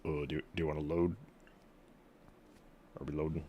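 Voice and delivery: normal talking voice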